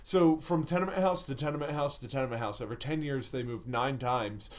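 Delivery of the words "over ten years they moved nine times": usual speaking voice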